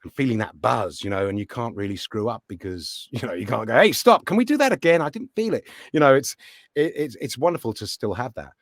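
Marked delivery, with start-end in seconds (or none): 3.8-5.7 higher-pitched pretend halt to the show; mock interruption. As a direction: call out a mock protest, easing off at the end. Acting out interrupting a live show.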